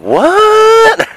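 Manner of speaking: very high, loud stretched what; baffled what. Retold or imitated. Gullible boy hearing of a fart rock, shrill disbelief. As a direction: squeal it in disbelief. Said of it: "And I'm like".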